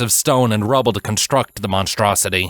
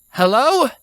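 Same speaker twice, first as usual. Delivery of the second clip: high, louder call; a lightly voiced wary call; 4 dB louder than usual. A narrator's reading. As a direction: call out to whoever hides. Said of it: "she called out again".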